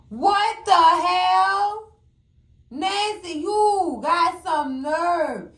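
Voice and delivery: drawn-out sung what the hell; shocked what the hell. Real, not acted. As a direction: stretch it like a disbelieving song. Shock at Nancy.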